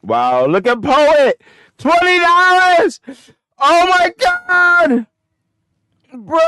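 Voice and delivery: very high, loud, stretched-out shriek; real excitement. Real, not acted. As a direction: yell at the screen in disbelief. Stock spikes live on screen.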